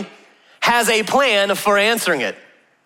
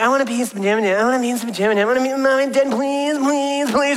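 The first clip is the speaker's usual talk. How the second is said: put-on high whiny kid begging; imitating children begging please please; kids pestering; 6 semitones higher, faster than usual. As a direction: plead like a kid desperate to stay up. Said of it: "They come and be like".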